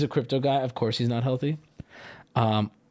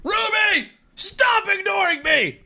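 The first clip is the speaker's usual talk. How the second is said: very high-pitched, drawn-out mock yell; hammed-up desperate scream at someone ignoring you; all-caps shouting; slower than usual. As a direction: read it as if every letter is capitalized. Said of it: "We are in all caps now".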